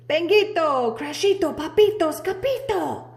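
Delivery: high, loud name-calling; excited roll call. Acted. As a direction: call each dog across the room. Calling the dogs' names.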